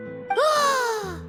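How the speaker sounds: high, soft gasp of wonder; amazed gasp. Acted. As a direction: gasp at a vanishing trick. Flower vanishes.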